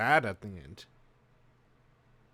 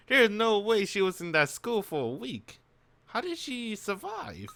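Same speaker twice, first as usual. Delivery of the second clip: high, loud retelling of disbelief; incredulous protest; 8 semitones higher, 3 dB louder than usual. Retold or imitated. Player recalls doubting she survived a week at school, incredulous. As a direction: recount your own baffled outburst, pitched right up. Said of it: "I was like".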